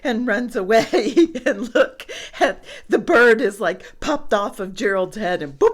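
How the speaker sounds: plain reading voice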